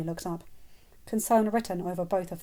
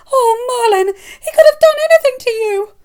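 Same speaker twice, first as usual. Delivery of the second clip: high, loud, near tears; panicked; 18 dB louder than usual. Acted. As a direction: cry out in frantic fear. Mother horrified for her son.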